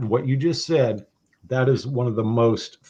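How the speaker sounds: ordinary delivery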